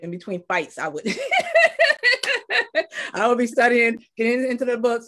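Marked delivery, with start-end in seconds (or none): 1.1-3.1 high burst of laughter. Real, not acted. Laughing at being a school nerd. 3.1-5.1 laughing. Real, not acted. Laughing through the words.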